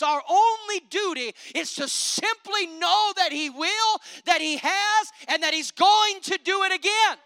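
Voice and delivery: loud, rising preacher's cadence; preaching at full force. Acted. Preacher rallying the church, loud and rising. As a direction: preach it out to the congregation.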